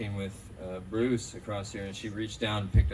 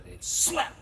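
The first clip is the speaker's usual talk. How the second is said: imitated slap noise.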